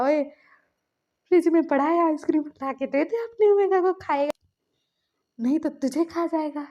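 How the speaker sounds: put-on older friend loudly ordering the boy to the fridge; bossy shout. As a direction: bark the order across the room. Suga orders him to fetch ice cream.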